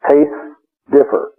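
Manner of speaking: Tastes, long pause, differ; two separate spoken words. Shown as a demonstration. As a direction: set each word apart with a pause. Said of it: "Two words".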